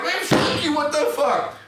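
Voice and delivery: very high-pitched retold outburst; incredulous angry retort. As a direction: re-enact a fed-up comeback, voice shooting up. His retort amid retold fight.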